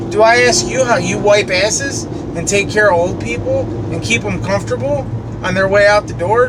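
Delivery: ordinary talk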